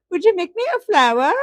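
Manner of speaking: put-on grandma doing her granddaughter's English accent. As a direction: imitate a three-year-old's English accent from Peppa Pig. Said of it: "an English accent".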